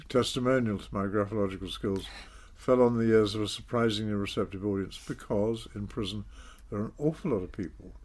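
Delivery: ordinary talk, nothing marked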